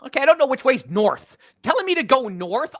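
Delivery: loud, high, comic indignation; exasperated protest. Retold or imitated. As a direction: protest in mock bewilderment at useless directions. Acted reply to being given directions.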